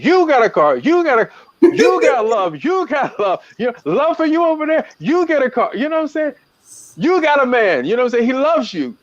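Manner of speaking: high, lively hammed-up announcer voice giving away prizes; Oprah Winfrey giveaway. Imitating Oprah handing out prizes, high and excited. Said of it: "do it, Oprah, Oprah Winfrey".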